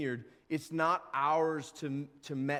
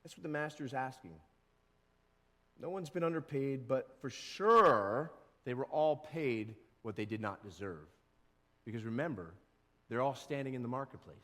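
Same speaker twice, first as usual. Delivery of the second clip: normal talking voice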